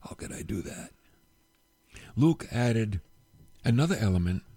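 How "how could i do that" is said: broken remorse trailing off; despairing whisper. Acted. Peter weeping over his denial, whispering in despair. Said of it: "crying and weeping".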